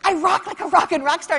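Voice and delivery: high, loud triumphant brag; bragging to sister. Retold or imitated. Speaker calling her sister Patty, bragging high and loud. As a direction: shout the win like a rock star. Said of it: "I called her".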